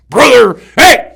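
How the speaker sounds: slow, high, very loud bellow; first sergeant's shout. Retold or imitated. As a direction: bellow it like a hard-core sergeant. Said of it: "he talked just like that".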